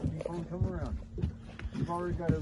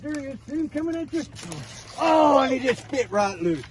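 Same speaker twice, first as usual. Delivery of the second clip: excited; 8 semitones higher, 7 dB louder than usual. Real, not acted. Fish escapes at the boat.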